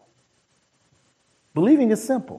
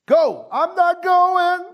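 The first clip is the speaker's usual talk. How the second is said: very high, drawn-out mock whine; whining refusal; slower than usual. Father refusing Jesus's order to go, high whine. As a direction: wail it like a stubborn child. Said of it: "stayed there and whined".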